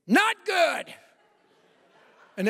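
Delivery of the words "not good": loud, much higher burst; sudden outburst. Retold or imitated. Wife blurting her pain to the Bible study group, loud and high. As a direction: blurt it out to the whole room.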